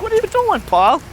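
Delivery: imitating an uncle calling out a question; uncle's questioning.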